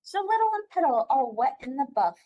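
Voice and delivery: high excited call; crying out. Acted. Ram calling out to everyone, high and excited. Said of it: "cried the Ram".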